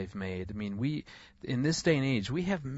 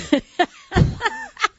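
loud high laugh; 10 dB louder than usual. Real, not acted. Laughing at the memory.

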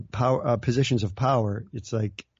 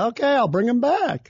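lightly voiced Trump, pitched up and louder; mock Trump; 9 semitones higher, 4 dB louder than usual. Trump tells the Democrats, mock-cheerful and higher. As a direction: toss off a cheeky mock announcement. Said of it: "and go".

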